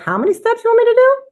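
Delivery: very high, fast, loud mock whine; exasperated protest. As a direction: squeak out an overwhelmed complaint. Acted protest at long skincare routine.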